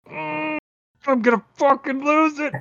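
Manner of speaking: hammed-up inner voice about to snap; inner rage. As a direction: let your inner voice crack with held-back rage. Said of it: "my brain is just like".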